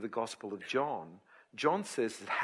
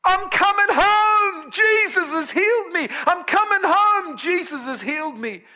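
joyful shouting; 14 dB louder than usual. Retold or imitated. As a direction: call out the news as you come home. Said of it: "when he starts shouting".

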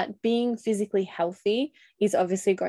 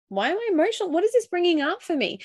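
much higher-pitched self-questioning; emotional self-questioning; 10 semitones higher, faster than usual. Acted. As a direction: voice jumps high, questioning yourself. Acting out anxious self-questioning.

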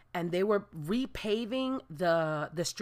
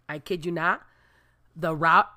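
usual speaking voice

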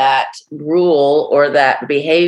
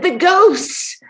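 high, frightened reply; scared whisper-like answer; 13 semitones higher than usual. Retold or imitated. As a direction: say it like the ghosts are real. Man says ghosts chase him.

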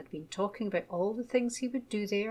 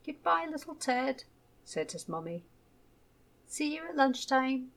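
fond goodbye; 6 semitones higher than usual. A narrator's reading. Mother's fond farewell.

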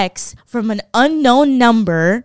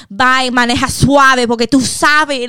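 hammed-up worried-mother warning; anxious Cuban mother; 4 semitones higher, 5 dB louder than usual. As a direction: imitate a fretting Cuban mom, loud and higher. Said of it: "hella fucking anxiety".

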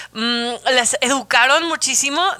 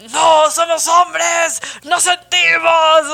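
macho men's voice; 6 semitones higher, 5 dB louder, much slower than usual. Retold or imitated. Brothers taught that men don't feel, shouted slowly. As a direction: boom it out like a proud tough guy. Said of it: "como que era como".

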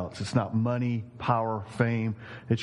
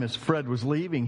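usual speaking voice

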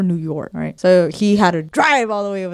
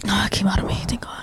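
voiceless hushed aside; whisper; 4 dB quieter, much faster than usual. Real, not acted. Telling viewers about a near fart, whispered. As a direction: breathe it out under your breath.

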